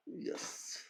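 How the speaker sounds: whispered yes. Real, not acted. Quiet self-confirmation at the board.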